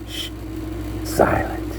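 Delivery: breathy hush to show silence; soft unvoiced whisper. Shown as a demonstration. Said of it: "silent, whispered".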